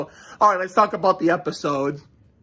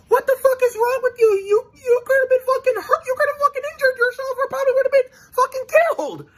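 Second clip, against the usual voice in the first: shrill, squealing hammed-up scolding, much higher and fast; mock furious uncle; faster than usual. Uncle scolding his reckless niece, shrill mock furious yell. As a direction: screech it like a frantic uncle scolding. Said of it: "he didn't say it like this, but this is how he meant this".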